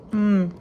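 loud, savoring mmm of pleasure; appreciative mmm. Real, not acted. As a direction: hum with delight at the taste. Tasting the crispy pork.

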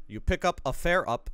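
ordinary talk, nothing marked

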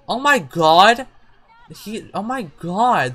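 loud, drawn-out oh my god; shocked outcry. Real, not acted. Player sees Sims yelling at each other, shocked. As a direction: exclaim in shock, stretching the words.

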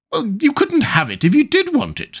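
very high queen's voice. A narrator's reading.

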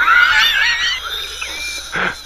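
shrieking victory laugh. Real, not acted. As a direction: cackle with disbelieving joy. Laughing after making it.

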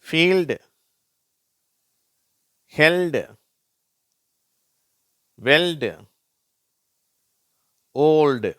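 "ஃபீல்டு, ஹெல்டு, வெல்டு, ஓல்டு" loud and high, ending in "ldu"; LD sound demo. Shown as a demonstration. Teacher reading LD examples, "ஃபீல்டு, ஹெல்டு, வெல்டு, ஓல்டு" loud and separate. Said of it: "இல்டு அப்படிங்கிற உச்சரிப்பை தருகிறது".

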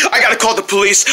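normal talking voice

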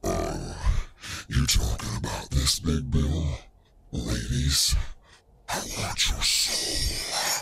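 lightly hammed-up soul-stealer, drawn out; put-on villain voice. Streamer teasing viewers in a mock menacing voice.

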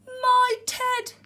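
loud, high cry of astonishment; big, high storybook outburst; gasped exclamation. A narrator's reading. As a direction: exclaim it as a startled story bear. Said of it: "gasped the bear".

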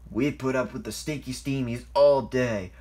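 complaint building to a loud, high bark; grumble, then explode on the repeat; fed-up rant rising to a shout; angry growl. A narrator's reading. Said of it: "growled Airy".

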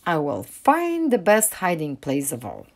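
ordinary delivery

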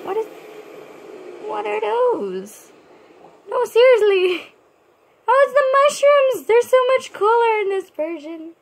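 squeaky high surprise that rushes out; voice shot way up. Real, not acted. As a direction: let the voice jump high in delight at the mushrooms. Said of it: "I don't know why my voice went like that".

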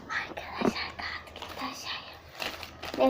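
slow breathy aside; slow whisper. Real, not acted. Girl whispering that the paper fell down. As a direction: whisper the mishap slowly.

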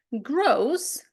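"gross" said grohs, with an oh glide; long-vowel "gross"; exception with long O. Shown as a demonstration. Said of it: "ми маємо винятки".

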